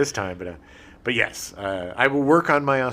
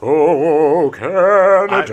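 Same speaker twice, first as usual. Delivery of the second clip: slow, loud, stretched "Canada"; drawn-out exclamation; 4 semitones higher, 8 dB louder, much slower than usual. Real, not acted.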